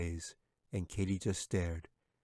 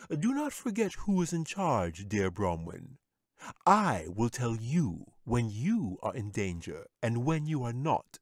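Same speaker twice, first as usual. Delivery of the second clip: haughty wizard; 4 semitones higher, 4 dB louder than usual. A narrator's reading. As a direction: put the bear in his place, smugly.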